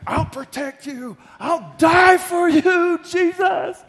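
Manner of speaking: high, loud, slow pledge of loyalty; eager vow. Acted. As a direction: shout out a desperate promise. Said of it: "Peter's like".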